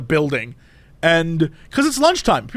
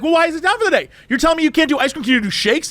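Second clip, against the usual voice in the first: high-pitched, outraged customer; indignant Karen; 8 semitones higher, much faster than usual. Retold or imitated. Woman complaining to drive-through cashier, indignant. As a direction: imitate an entitled complainer, pitched up. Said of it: "she's going full Karen".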